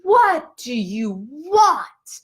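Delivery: slow, high, drawn-out demand; exasperated demand. Retold or imitated. Student looking up at cawing crows, exasperated.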